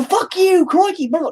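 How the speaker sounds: very high-pitched mock cursing impression; drunken-sailor cussing. Streamer imitating a nonstop-cussing English buddy, high mock-angry outburst. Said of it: "talks like a goddamn drunken sailor".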